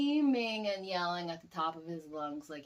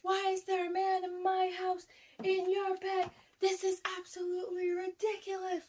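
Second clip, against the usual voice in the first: much higher, outraged stepdad; stepdad freaking out; 12 semitones higher than usual. Retold or imitated. Stepdad scolding daughter over a man in bed, high outraged voice. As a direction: imitate the stepdad losing it, high and indignant. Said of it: "he started freaking the f-, he freaking out".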